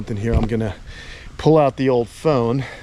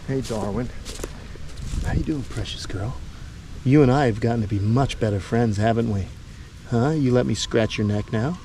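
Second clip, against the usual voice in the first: softer cooing to a beloved pet; soft pet talk; 4 dB quieter than usual. Real, not acted. Owner fondly cooing to his tortoise Darwin, soft.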